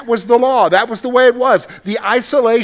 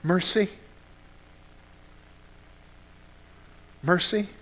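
desperate prayer; 3 semitones higher than usual. Pretend. Hint of a worshipper at a loss, begging God. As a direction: plead with God, barely finding words. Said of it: "saying to God".

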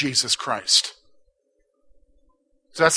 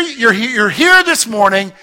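ordinary delivery

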